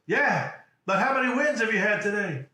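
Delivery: loud, high holler; insistent coach yell. Retold or imitated. Coach to crying player, loud high insistence. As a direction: shout right over the protest. Coach yells the question back.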